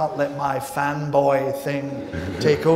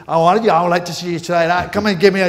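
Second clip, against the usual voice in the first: heated improv shout; 5 semitones higher, 9 dB louder, faster than usual. Retold or imitated. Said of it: "more and more heated and heated".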